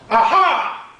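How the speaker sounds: high, loud cry of discovery; triumphant aha. A narrator's reading. Mom spotting the snail, high loud aha. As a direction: shout it like you've found treasure. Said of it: "Mom suddenly cried".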